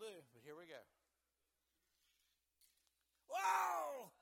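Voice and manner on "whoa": loud high whoa as the car flies; startled outburst; a real loud yelp of surprise; surprised shout. Real, not acted. Toy car flies off track.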